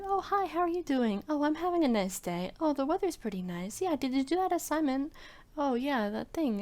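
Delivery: high, airy polite chatter; empty schoolyard niceties; polite small talk. Retold or imitated.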